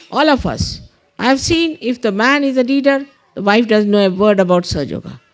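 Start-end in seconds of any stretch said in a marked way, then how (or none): none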